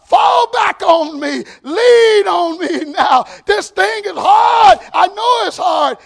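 high, loud, slow cries with stretched words; full-force preaching. Real, not acted. Preacher voicing a believer and God's answer, full-force preaching. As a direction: cry out each plea like a sermon climax.

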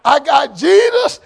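loud, drawn-out preacher's call; lightly voiced old-time preacher calling out. Preacher quoting an old church song to the congregation, old-preacher cadence. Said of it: "that old preacher's like".

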